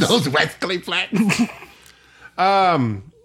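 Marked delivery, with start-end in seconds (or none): 0.1-1.2 laughing Elmer Fudd lisp. Pretend. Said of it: "Wascally Flats".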